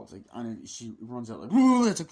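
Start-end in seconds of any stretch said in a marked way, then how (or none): 1.5-1.8 loud high startled whoa; hammed-up scare shout; mock startled yell. Imitating jump scare.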